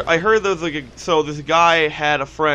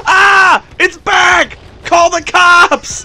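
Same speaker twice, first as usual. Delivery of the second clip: much higher, louder slow yell; mock scream; 14 semitones higher, 10 dB louder, much slower than usual. The cat returns, loud laughing mock scream. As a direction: scream that it's back.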